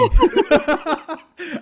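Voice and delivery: high burst of laughter; big real cackle; loud laugh. Real, not acted. Laughing at his own meme.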